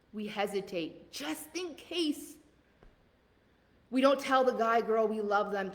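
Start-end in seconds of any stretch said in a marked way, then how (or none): 1.1-2.4 slow, high, soft just in case; soft high refrain. Pretend. Mocking fearful people, a slow soft high just in case. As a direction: tiptoe the words timidly.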